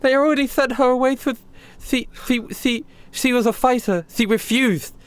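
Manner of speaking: a hint of a worried captive; lightly voiced Heather. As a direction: Heather telling Bradley about his sister. Heather explains Selena's fate.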